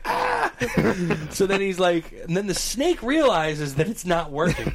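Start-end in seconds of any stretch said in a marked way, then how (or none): 0.0-0.5 very high imitated yelp; mock shriek. Storyteller imitates his friend as the snake hits the shoe, mock shriek. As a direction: squeal like the startled friend, hammed-up. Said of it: "and he's like".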